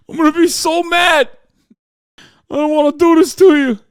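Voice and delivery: hammed-up wailing New York cop, high and shouted; New York cop accent. Host as crying cop apologizing to Trump, shouted New York accent. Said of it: "wouldn't have that kind of accent".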